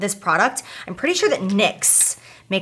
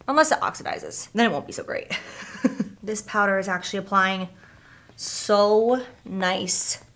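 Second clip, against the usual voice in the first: normal talking voice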